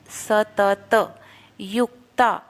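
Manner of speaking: सतत युक्ता slow, य and क joined; joined syllables. Shown as a demonstration. Teacher drilling युक्ता slowly, joining the half क. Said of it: "य के ऊपर आघात है".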